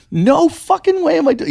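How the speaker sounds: high-pitched retold protest; adamant refusal. Refusing a friend's dare, retold.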